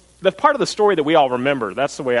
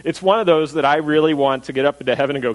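normal talking voice

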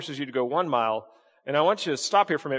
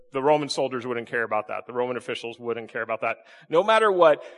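ordinary delivery

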